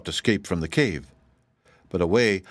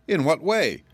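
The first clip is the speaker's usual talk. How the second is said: trembling; 8 semitones higher than usual. A narrator's reading. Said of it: "trembling a little".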